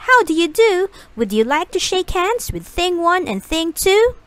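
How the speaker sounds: high, bright-eyed eager creatures; mischievous creatures politely greeting the children; Things' greeting. Acted.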